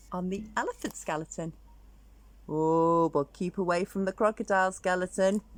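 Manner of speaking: usual speaking voice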